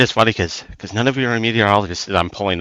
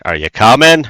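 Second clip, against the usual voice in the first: louder, lifted call to companions; calling back; 3 semitones higher, 6 dB louder than usual. Acted. Hiker to lagging party on the trail, calling out. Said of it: "just be like".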